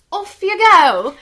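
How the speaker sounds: loud, high mock-British call; British accent. Imitating her co-host's show opener, in a British accent. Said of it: "I don't know how my British accent".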